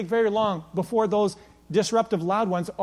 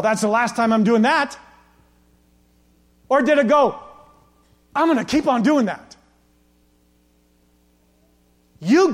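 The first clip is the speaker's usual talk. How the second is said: loud, high, driving preacher voice; full-force preaching; 7 semitones higher, 9 dB louder than usual. Acted. Pastor to the congregation, preaching at full force. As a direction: preach it out to the men, building.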